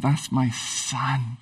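very slow, hushed and low; quiet, drawn-out tenderness; tender fatherly faith. Acted. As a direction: say it softly, pondering with fatherly love. Acts father pondering his son.

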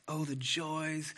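soft, slow reading of the psalm; quiet devotional reading; scripture reading. Acted. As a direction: read it gently, savoring each phrase. Reading Psalm one aloud.

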